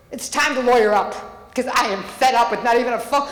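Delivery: ordinary talk, nothing marked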